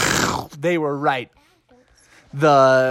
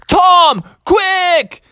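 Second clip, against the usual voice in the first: high, loud frantic call; panicked calling out; urgent shout; 5 dB louder than usual. Acted. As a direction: yell to hurry him out.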